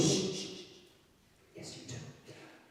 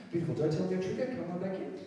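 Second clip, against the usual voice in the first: ordinary delivery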